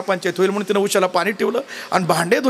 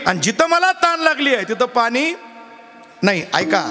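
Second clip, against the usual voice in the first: normal talking voice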